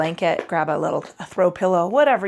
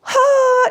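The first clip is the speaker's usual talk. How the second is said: loud, high exhaled sigh; big sigh; 9 dB louder than usual. Real, not acted. Yoga teacher opens relaxation flow, big sigh.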